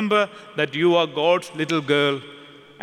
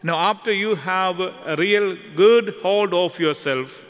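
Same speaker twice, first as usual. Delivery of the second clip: ordinary talk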